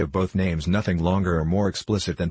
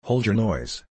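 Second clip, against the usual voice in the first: understated menacing cry, slightly raised; a touch of a fearsome convict; terrible voice; 2 semitones higher, slower than usual. A narrator's reading. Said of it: "cried a terrible voice".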